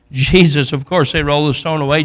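ordinary talk, nothing marked

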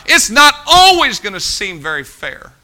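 said out loud. Real, not acted. Preacher admitting a hard truth to the congregation, said out loud. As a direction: say it out loud, leaning on "always". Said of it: "say it out loud".

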